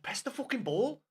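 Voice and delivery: half-shouted plea at the telly; urging the team. Retold or imitated. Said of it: "And I was like".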